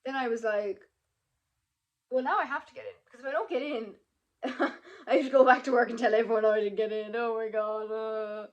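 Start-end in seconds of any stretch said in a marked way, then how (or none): 2.1-8.5 loud, laughing hammed-up despair; mock dread. Student imagining telling everyone at work, comically despairing. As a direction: wail it out in comic dismay.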